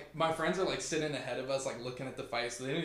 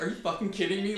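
high, loud retold disbelief; mock indignation; 8 semitones higher, 7 dB louder, faster than usual. Friend reacting to being told no Southern accent, mock indignant. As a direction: imitate an exasperated friend, pitched up and louder.

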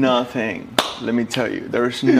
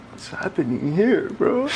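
mock surprise; 8 semitones higher than usual. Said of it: "And I was like".

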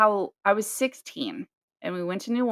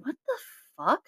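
retold shock; 7 semitones higher, 4 dB quieter than usual. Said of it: "and I'm going".

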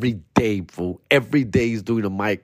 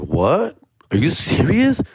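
surprised; 6 semitones higher, slower than usual. Retold or imitated. Told to pick up Jeff.

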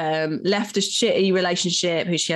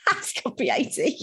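shocked; 13 semitones higher, 4 dB quieter than usual. Real, not acted. Shocked guess at actor's age.

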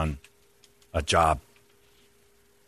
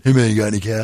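lightly voiced Scottish beggar; Scottish accent; faster than usual. Shown as a demonstration. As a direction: ask for cash in a Scottish lilt. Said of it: "do it in a Scottish accent".

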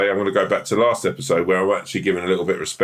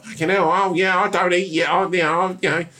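high, babbling, losing your mind; flustered panic; 9 semitones higher than usual. Retold or imitated. Visitor assuring the fruit he's not a threat, high and panicky. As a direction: stumble over the words in mock panic. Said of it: "you'd be like".

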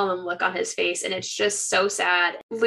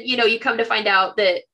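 usual speaking voice

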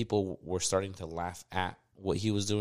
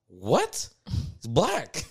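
louder, higher baffled retort; mock puzzled guy; 6 semitones higher, 6 dB louder, much slower than usual. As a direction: play the confused guy. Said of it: "the guy's like".

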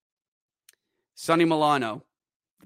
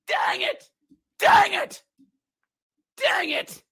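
much higher, pained groan of words; frustrated cry; slower than usual. Real, not acted. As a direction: wail it in frustration. After conceding overtime goal.

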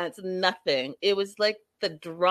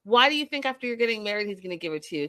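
usual speaking voice

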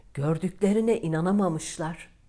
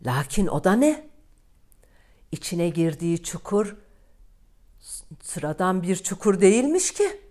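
louder, higher, rising amazement; surprised narration; 6 semitones higher, 8 dB louder than usual. A narrator's reading. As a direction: build it up like a shocking discovery. Storyteller's surprise reveal. (şaşkınlıkla yükselen anlatım)

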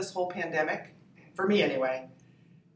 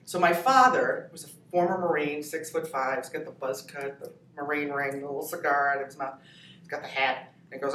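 usual speaking voice

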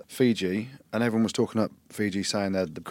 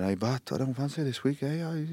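light put-on Welsh accent, rising ends; lilting Welsh accent, imitating a player; 5 semitones higher than usual. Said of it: "Is that your Welsh accent?".

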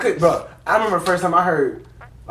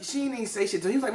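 usual speaking voice

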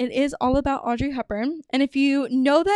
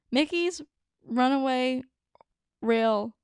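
slow, careful. Shown as a demonstration. Host slowly fixing the ride name for listeners, careful. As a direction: say "Mickey's Runaway Railway" slowly, pausing between words. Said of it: "that's really hard to say".

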